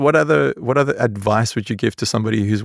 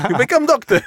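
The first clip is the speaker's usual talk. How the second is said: laughing, high Lebanese uncle voice; hammed-up Lebanese accent, laughing through it; bad Lebanese accent; 10 semitones higher than usual. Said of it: "I do a very bad Lebanese accent".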